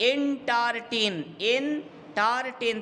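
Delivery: এন্টারটেন slowly broken as এন-টার-টেন; syllable by syllable. Shown as a demonstration. Teacher counting three syllables, এন্টারটেন slowly sounded out in parts. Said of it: "তিনটে syllable আছে".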